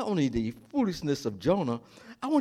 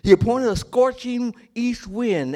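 emphatic; 7 semitones higher, 6 dB louder than usual. Real, not acted. Sermon emphasis.

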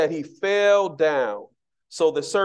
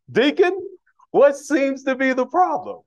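higher and louder, a mock stern pastor calling out the deacon; hammed-up pastor demanding an answer; booming pastor voice; 8 semitones higher, 4 dB louder than usual. Pastor questioning the deacon at the church door, hammed-up booming and high. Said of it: "Pastor don't talk like that".

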